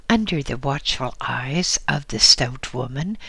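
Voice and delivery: plain reading voice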